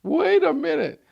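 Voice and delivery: high, loud startled objection; alarmed protest. Retold or imitated. Abraham loudly protesting to God in alarm. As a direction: pull up short in alarm. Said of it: "he has, like".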